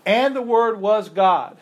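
emphatic quoting. Shown as a demonstration. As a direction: read it out with weight. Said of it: "Jehovah's Witnesses make their argument".

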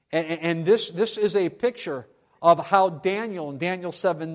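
emphatic preaching. Acted. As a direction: preach it out with force, landing hard on key words. Preacher at full force.

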